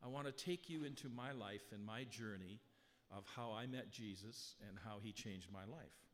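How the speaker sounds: normal talking voice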